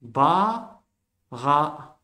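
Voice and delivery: Ba, then ra, split apart with a pause; syllable by syllable. Shown as a demonstration. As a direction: split Ba and ra to show the reading. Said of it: "On lit en diagonale la grande lettre".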